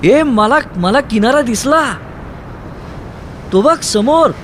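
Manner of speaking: thrilled yell; excited shout. Acted. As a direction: shout it over the storm. Shouting the shore is seen.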